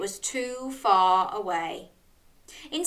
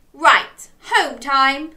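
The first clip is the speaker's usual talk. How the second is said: loud, higher crab voice; brisk announcement; 4 semitones higher, 8 dB louder, much slower than usual. A narrator's reading. Crab briskly announces going home.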